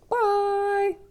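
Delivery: a high, loud, sing-song bye; sing-song goodbye. Real, not acted. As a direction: wave them off with a lilting bye. Signing off video message.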